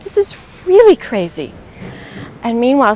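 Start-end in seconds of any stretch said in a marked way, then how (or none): 0.0-1.5 incredulous inner voice. Retold or imitated. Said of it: "my mind is like".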